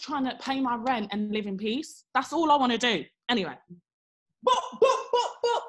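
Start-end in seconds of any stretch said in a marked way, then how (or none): 4.5-5.6 high, loud "boop boop" knocks, laughing; imitated knocking with a laugh. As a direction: mimic the banging on the door again, amused. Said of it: "Looking through the keyhole".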